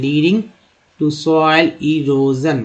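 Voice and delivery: drawn out, syllable by syllable; very slow read aloud; slow reading demo. Shown as a demonstration. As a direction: sound it out slowly for learners. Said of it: "तो इस तरह से दोस्तों आप पढ़ना सीख सकते हैं".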